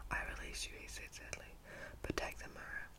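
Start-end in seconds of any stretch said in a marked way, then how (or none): none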